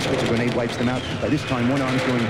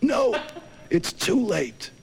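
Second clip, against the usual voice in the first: mock dying hero; 11 semitones higher, much slower than usual. Dying soldier's line.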